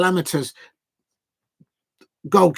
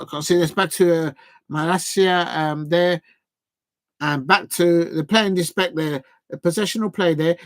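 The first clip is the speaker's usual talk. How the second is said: usual speaking voice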